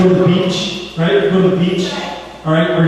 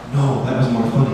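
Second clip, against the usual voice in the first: low-pitched talking cat; deep-pitched creature reply; raspy cat voice; 6 semitones lower, 3 dB quieter than usual. Acted. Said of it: "this raspy voice".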